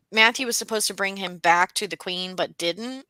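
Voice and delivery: ordinary talk, nothing marked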